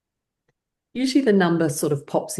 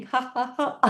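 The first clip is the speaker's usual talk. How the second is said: high, bouncing exercise laugh; laughing while shaking; 9 semitones higher than usual. Shown as a demonstration. Teacher shakes body while laughing, high rolling laugh. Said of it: "shaking of the body while laughing".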